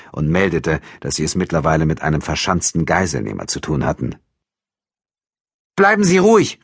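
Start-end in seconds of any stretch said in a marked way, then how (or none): 5.8-6.6 loud, high command; forceful raised voice; calling out. A narrator's reading. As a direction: shout it to a gunman inside. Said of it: "rief Franziskowitsch dem Mörder zu".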